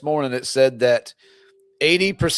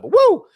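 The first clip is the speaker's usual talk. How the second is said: sharp high whoop of energy; amped whoop; 6 dB louder than usual. Real, not acted. Amped up after the gym, a loud high whoop. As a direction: let out a loud excited woo. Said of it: "I'm a little amped up".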